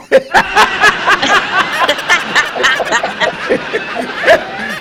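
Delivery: big hearty laugh. Real, not acted. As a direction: burst out laughing. Laughing at own joke.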